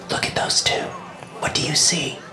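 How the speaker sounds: soft unvoiced whisper. A narrator's reading. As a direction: whisper it close to his ear. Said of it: "she whispered to me".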